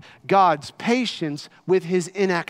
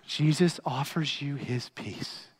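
usual speaking voice

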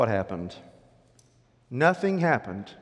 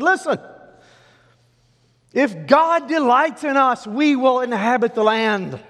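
impassioned exhortation; 12 semitones higher, 9 dB louder than usual. Acted. Joshua urging Israel not to rebel, impassioned. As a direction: plead with the crowd at full force. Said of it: "Joshua spoke up".

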